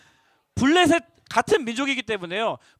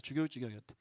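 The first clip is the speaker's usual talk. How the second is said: much softer muttered repeat of 죽을 지경이었대; quiet echo; 11 semitones lower, 15 dB quieter, faster than usual. Real, not acted. Preacher echoing 죽을 지경이었대 under his breath. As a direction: drop the voice and echo 죽을 지경이었대 to yourself.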